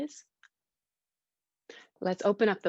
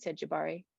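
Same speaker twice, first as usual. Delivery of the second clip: ordinary delivery, nothing marked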